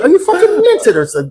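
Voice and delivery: very high mock English girl; imitated English accent. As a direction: ask it as a hammed-up English girl. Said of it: "this is the girl goes".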